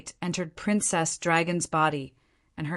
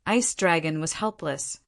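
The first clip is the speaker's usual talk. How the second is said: ordinary delivery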